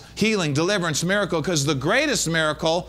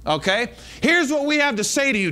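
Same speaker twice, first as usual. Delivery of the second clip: ordinary delivery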